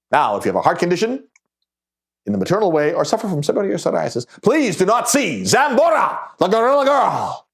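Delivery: carnival barker's warning. Acted. Barker warns people with heart conditions, calling out. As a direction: announce a mock warning like a sideshow barker. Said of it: "the barker calling out".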